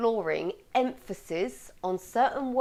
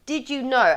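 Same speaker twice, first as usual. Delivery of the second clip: higher, louder question landing on "know"; stress on KNOW; 5 semitones higher, 7 dB louder than usual. Shown as a demonstration. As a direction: punch the word "know". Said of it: "found myself emphasising no".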